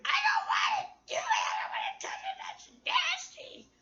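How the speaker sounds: put-on very high squealing kid voice; whiny disgusted kids. Her kids refusing to help, a high disgusted squeal.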